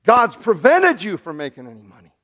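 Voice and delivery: a hammed-up furious dismissal; angry king. Balak firing Balaam in anger, building mock rant. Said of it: "claps his hands in anger".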